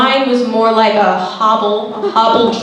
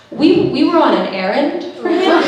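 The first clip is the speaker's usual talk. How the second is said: ordinary delivery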